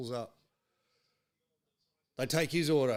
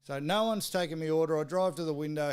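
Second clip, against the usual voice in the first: normal talking voice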